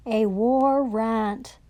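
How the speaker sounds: slow, drawn-out scorn on "A war rant."; mocking slow "war rant"; sneering. Real, not acted. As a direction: spit out "war rant" slowly in contempt. Sneering at the document wording.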